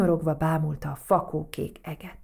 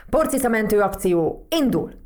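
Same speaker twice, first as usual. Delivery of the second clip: louder, higher mission launch; rallying command; 4 semitones higher, 7 dB louder than usual. A narrator's reading. Toad launching the dust bunny rescue, rallying command. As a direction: announce the start like a rallying call.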